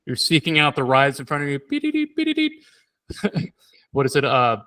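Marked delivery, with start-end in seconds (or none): 1.7-2.6 high-pitched electronic beep noises; imitated robot beeps. Comparing the guest to Terminator, imitated robot beeps. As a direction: beep like a scanning machine.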